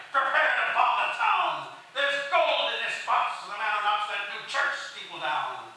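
loud, high rallying shout; pirate crying out. Acted. Pirate offers gold to the gunners, loud high shout. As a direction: bellow it to rouse the crew. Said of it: "a pirate cried".

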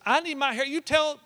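high, loud imitation of a fussing grandma; fretful fast-talking grandma. Grandma in recovery fussing at her family, urgent. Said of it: "going a million miles an hour".

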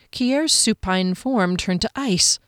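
plain reading voice

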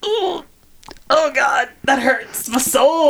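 high, drawn-out pained reaction; mock wounded. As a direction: groan it high, as if hurt. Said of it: "that's always been like".

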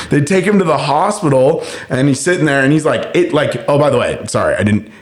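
ordinary delivery, nothing marked

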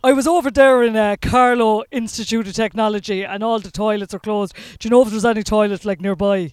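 put-on loud Irish Midlands student in a Carlow accent. Student asking a stranger for a toilet, Carlow accent. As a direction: go full Carlow accent, voice pushed up in volume. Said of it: "There's the Carlo accent".

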